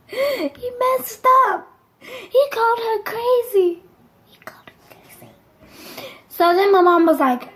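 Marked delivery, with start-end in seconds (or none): 0.1-1.7 gasping, high drawn-out dismay; dramatic dismay. Acted. Storyteller reacting to dad's big mistake, hammed-up dismay. 2.0-3.8 high, slow horrified disbelief; dramatic disbelief. Acted. Storyteller shocked at what dad said, dramatic disbelief. Dreading mom's reaction. 4.3-5.3 voiceless dramatic whisper. Acted. Storyteller repeating dad's fatal mistake, dramatic whisper.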